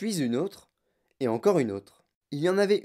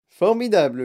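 loud, higher burst of enthusiasm; delighted exclamation; 5 semitones higher, 7 dB louder than usual. A narrator's reading. Wonka admiring his marshmallow pillows, exclaiming.

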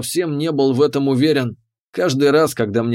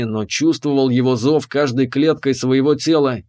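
ordinary delivery, nothing marked

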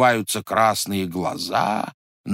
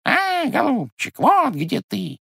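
very high, slow mocking squeak; high-pitched bird echo, stretched out; squeaky bird's beak; 13 semitones higher, slower than usual. A narrator's reading. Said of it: "запищал птичий нос".

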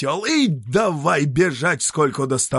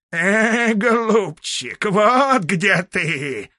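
high, slow bleating voice; bleating ram's head; 8 semitones higher, 5 dB louder, much slower than usual. Acted. A ram's head bleats mockingly from the treetop. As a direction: bleat it out like a ram. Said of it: "заблеяла баранья голова".

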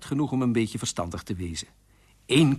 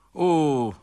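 mild lament; 3 semitones higher, 5 dB louder than usual. Acted. Weeping king begins his lament.

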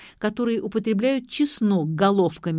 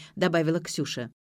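ordinary delivery

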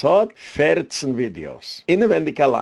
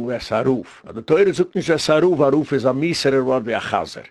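ordinary talk, nothing marked

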